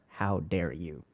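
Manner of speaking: mock angry parent, low and soft; a low, hushed parental rebuke.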